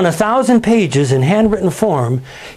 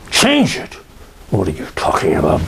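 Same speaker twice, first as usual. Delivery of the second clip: indignant outburst; 9 semitones lower than usual. Retold or imitated. Steinbeck outraged at the publisher.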